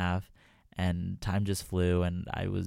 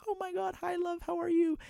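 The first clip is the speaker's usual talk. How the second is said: a hint of an English accent, pitched way up; lightly imitated English accent. Fan imitating the singer greeting her, light English accent. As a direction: gush a bubbly English hello, higher. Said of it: "just picture her with an English accent".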